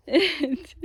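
surprised. Real, not acted. Dog suddenly approaches.

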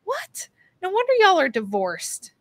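sharp high "What?" then a laughing jab; disbelieving, mocking the in-laws; high, incredulous laugh-through reply; incredulous retort. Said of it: "And I'm just like".

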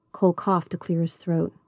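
usual speaking voice

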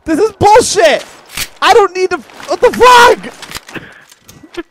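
high, loud outraged yelling; frantic complaint rising to a scream; panicked protest. Real, not acted. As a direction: shout in frightened indignation. Frightened, protesting the game.

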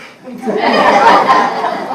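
loud, breathy burst of laughter; real laughter. Real, not acted. Firefighter cracking up at Barney's joke, loud breathy laughter. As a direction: let the laugh spill out.

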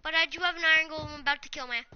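ordinary talk, nothing marked

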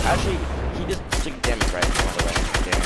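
ordinary talk

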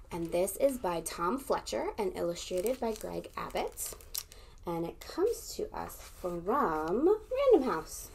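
normal talking voice

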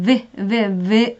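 V with teeth on lip, buzzing; V sound demo. Shown as a demonstration. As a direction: bite lightly and buzz V. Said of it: "이렇게".

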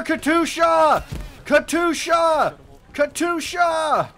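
slow, loud, high alarm cry; drawn-out warning shout. Real, not acted. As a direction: stretch it out to warn everyone. Rocket barrage warning.

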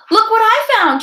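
high, triumphant call; triumphant shout. A narrator's reading. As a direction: announce the find in triumph. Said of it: "she shouted triumphantly".

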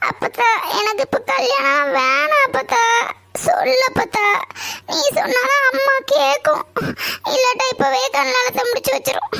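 high, tearful whining plea; fake crying. Granddaughter begging grandmother to stop the wedding, pretend crying. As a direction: beg grandmother through put-on sobs. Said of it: "அவ ஒரு நாள் அழுதது இல்லையே".

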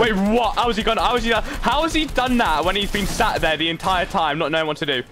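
high, stunned outburst; baffled protest rising high, then easing down; incredulous. Real, not acted. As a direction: demand an explanation in sheer disbelief. Amazed by opponent's sudden feat.

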